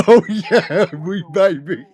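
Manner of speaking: high laughing mock British accent; bad British accent. Repeating the movie's bad British accent, high laughing mock British. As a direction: redo the awful British accent, cracking up. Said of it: "We're doing British accents".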